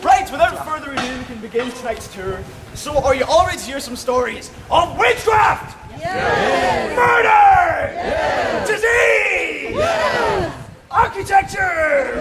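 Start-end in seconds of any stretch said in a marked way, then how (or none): 2.7-12.2 high, loud rallying shouts; call-and-response hype. Acted. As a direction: whip the crowd into cheering.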